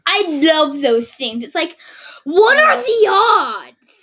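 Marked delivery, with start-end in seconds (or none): 2.2-4.0 high, slow, drawn-out what are the odds; amazed. Real, not acted. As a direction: marvel at it, higher and louder. Rhetorical exclamation about winning.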